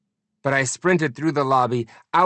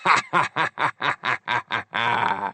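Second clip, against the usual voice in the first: mocking laugh fading away; villain laugh. A narrator's reading. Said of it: "he laughed and laughed".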